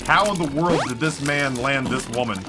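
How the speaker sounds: ordinary talk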